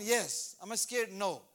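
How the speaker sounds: usual speaking voice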